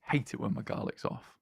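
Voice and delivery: breathy half-joking hush; mock whisper. Co-caster jokes about garlic, in a mock whisper. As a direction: whisper it like a guilty secret.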